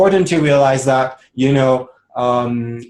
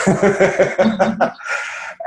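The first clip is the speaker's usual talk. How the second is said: laugh; 8 semitones higher than usual. Real, not acted. Laughs after quote.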